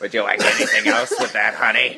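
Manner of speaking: mock waitress calling him honey; diner waitress. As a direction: serve pie like a folksy waitress. Pretend diner waitress serving pie.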